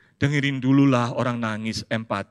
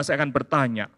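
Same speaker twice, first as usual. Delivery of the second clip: usual speaking voice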